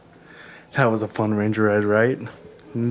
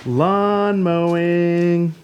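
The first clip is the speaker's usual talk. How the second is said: higher, very slow sing-song; drawn-out reveal; 6 semitones higher, much slower than usual. Acted. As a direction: stretch it out like a big reveal. Reveals the chore playfully.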